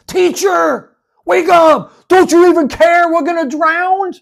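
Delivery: high desperate shouting; panicked shout. Acted. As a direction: yell over the storm in terror. Disciples wake Jesus in storm.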